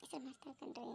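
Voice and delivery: mother crying with pride over her daughter. Acted. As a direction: fade into quiet weeping. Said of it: "ನೀವ್ ಅಳ್ಬೇಡ್ರಿ".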